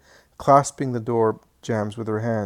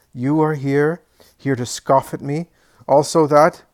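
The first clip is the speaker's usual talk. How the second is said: bitter angry outburst; 4 semitones higher, 8 dB louder than usual. Acted. Old man confronts Jesus angrily.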